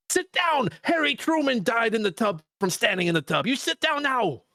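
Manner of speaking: high goofy Salsa Man scolding. Pretend. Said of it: "I'd be like".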